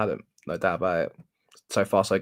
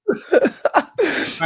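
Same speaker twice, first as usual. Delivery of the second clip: loud laugh; 5 dB louder than usual. Real, not acted. Laughing at his mate's sore hand.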